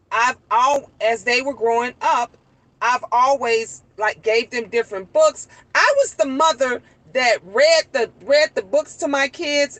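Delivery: usual speaking voice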